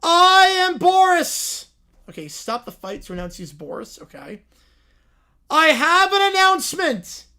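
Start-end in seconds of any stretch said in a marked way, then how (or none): 0.0-1.7 loud, slow ring-announcer proclamation; booming referee Boris. Acted. Boris announces himself to the fight crowd, slow and booming. 5.5-7.3 loud, high proclamation; booming Boris announcement. Acted. Boris grabs the crowd's attention, loud and high.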